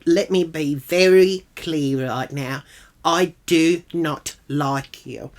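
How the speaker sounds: slow, spaced-out firm statement; emphatic, measured put-down; very deliberate. Retold or imitated. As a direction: spell it out slowly, word by word. Spelling out dislike in acted quarrel.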